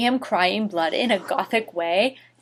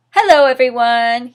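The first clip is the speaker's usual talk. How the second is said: happy greeting; 3 semitones higher, 7 dB louder than usual. Acted. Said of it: "he said happily".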